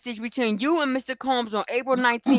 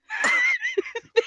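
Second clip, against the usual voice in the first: a very high burst of laughter; high squealing laugh. Real, not acted. Laughing at the comparison.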